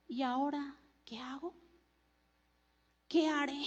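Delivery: very soft inward wondering; hushed self-questioning. Acted. Sinner who heard God's voice asking himself, hushed. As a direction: ask yourself under your breath, almost lost.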